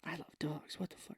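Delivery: soft whispered aside; whispered joke aside. Pretend. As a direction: breathe it out as a secret.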